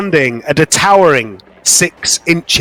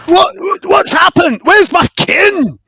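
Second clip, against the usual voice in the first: high frantic Scottish cry, slowing on the question; alarmed Scottish mycon; 13 semitones higher than usual. Acted. Fergus crying out for his missing kin, high frantic Scottish voice.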